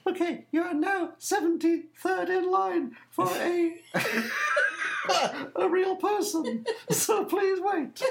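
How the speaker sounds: mock-cheerful recorded voice; automated phone voice. Caller mocking the queue message, high and giggly. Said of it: "And they said".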